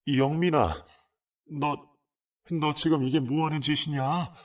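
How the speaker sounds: mother's scolding shout building up. Retold or imitated. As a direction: yell at the son, rising to a shriek. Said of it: "하면서 소리쳤더니".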